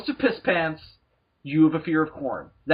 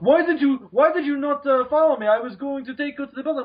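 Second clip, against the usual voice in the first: irate seller; 9 semitones higher than usual. Retold or imitated. Seller angry that he lost his customer, high indignant whine. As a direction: play the offended hustler, high and outraged.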